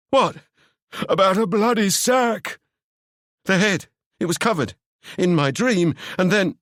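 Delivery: high, agitated character voice; Seb's voice. Acted. Seb explaining his dream to Ewan, high and rattled.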